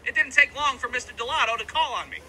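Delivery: ordinary talk, nothing marked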